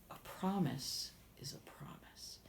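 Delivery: hushed stern whisper; whispered king. Acted. King whispering firmly to his daughter. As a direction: press the rule in a whisper.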